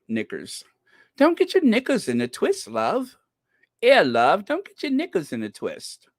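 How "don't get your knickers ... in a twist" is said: put-on British accent, teasing; mock British. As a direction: chide someone in a playful British accent. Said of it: "British for underwear".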